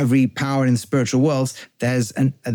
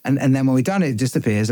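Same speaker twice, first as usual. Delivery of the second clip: normal talking voice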